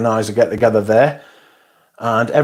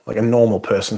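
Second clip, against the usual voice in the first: normal talking voice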